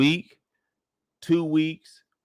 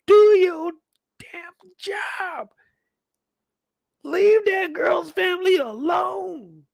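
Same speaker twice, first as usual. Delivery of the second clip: high hoarse whisper-shout then bellow; captain threatening to fire Burke; furious captain; slower than usual. Retold or imitated.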